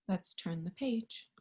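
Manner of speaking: usual speaking voice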